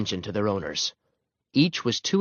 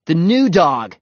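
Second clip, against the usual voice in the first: loud, higher reply; called answer; 6 semitones higher, 7 dB louder, slower than usual. A narrator's reading. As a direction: call the verdict over the noise. Boy answers over the fight.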